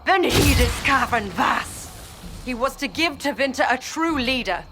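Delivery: high, impassioned outcry of betrayal; betrayed outburst. Acted. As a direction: let the anger at being deceived ring out. Betrayed follower learns the truth.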